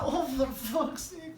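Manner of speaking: the couple despairing as Big Sargy kicks off; high slow dismay. Retold or imitated. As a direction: groan it out slowly and high.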